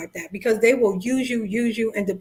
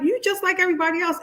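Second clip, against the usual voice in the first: mock accusation; 7 semitones higher, much faster than usual. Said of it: "they're like".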